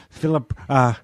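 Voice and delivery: ordinary talk, nothing marked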